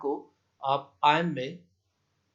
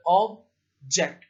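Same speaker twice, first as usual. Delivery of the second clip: Object split into two separate beats; syllable by syllable; 5 dB louder, much slower than usual. Shown as a demonstration. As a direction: say ऑब, pause, then जेक्ट. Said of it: "दो syllable हैं".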